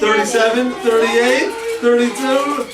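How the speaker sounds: loud, high counting chant; excited counting. Real, not acted. Counting aloud, joking numbers.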